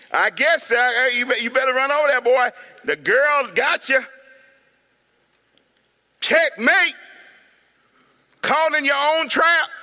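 mocking loud taunt, high; taunting onlooker. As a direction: jeer at him from the sidelines. Preacher voices mocking onlooker.

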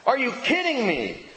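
high, incredulous outburst; emotional response. Acted. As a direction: balk at a ridiculous question. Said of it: "hearing the question raised in his own mind and going".

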